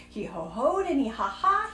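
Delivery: ordinary delivery, nothing marked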